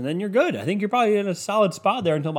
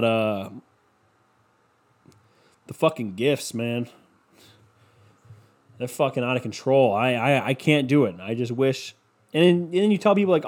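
ordinary talk

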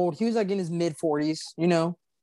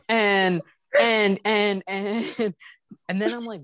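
normal talking voice

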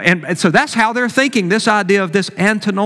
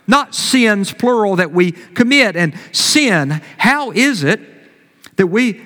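ordinary delivery

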